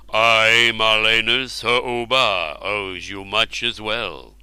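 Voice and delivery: slow, grand royal voice; royal king voice. Acted. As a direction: speak slowly like a king. Ubar speaks.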